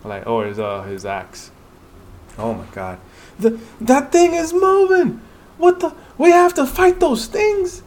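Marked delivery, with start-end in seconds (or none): 3.4-7.8 much higher, louder panicked voice; frightened hunter shouting at moving statues; terrified cry. Acted. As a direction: shriek as the statues come alive. Hunter sees the statues move.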